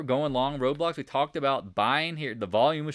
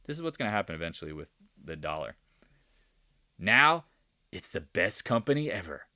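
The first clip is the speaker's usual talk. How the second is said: normal talking voice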